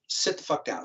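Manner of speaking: a hissed, voiceless order; hushed threat. Retold or imitated. Jesse orders the narrator to sit, a voiceless menacing whisper.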